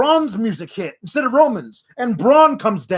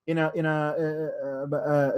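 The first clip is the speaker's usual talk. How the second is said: normal talking voice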